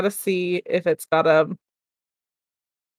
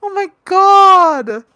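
drawn-out horror; 10 semitones higher, 6 dB louder, much slower than usual. Real, not acted. Horrified by video.

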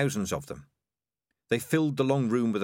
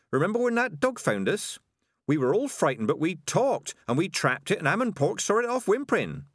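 put-on higher-pitched, eager young rat; Toxie the young rat; 8 semitones higher than usual. A narrator's reading. Young rat recalling a dog attack, higher-pitched and insistent.

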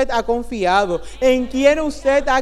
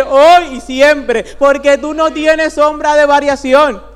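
louder, impassioned declaration to the Lord; full-force preaching prayer; 3 semitones higher, 6 dB louder than usual. Acted. Preacher crying out to the Lord before the church, full-force preaching. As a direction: proclaim it boldly, building to the last line.

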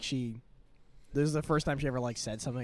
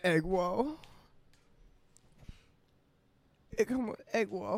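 high put-on broken-English accent; mock foreign accent; 6 semitones higher than usual. Friend echoing egg roll meme, mock foreign accent. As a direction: mimic the accent, voice lifted.

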